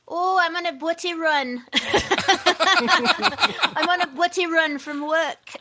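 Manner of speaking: Manchester accent, loud and laughing; a Mancunian on a lunch run; hammed-up Manchester accent, laughing through it. Shown as a demonstration. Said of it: "off the cuff, what could I say?".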